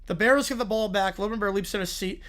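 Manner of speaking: ordinary talk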